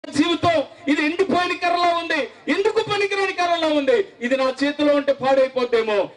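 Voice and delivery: high, pleading preacher's call, pitched well up; fervent altar call. Acted. Preacher calling youth to give their lives, high pleading cry. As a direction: urge the young people to surrender.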